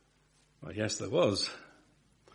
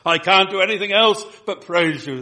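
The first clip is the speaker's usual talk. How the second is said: higher and much louder, bursting out; emphatic; 8 semitones higher, 11 dB louder than usual. Shown as a demonstration. Preacher voicing overflowing praise to the congregation, loud and high. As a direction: throw the whole line out loud and high, unable to hold it in. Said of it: "Get the emphasis".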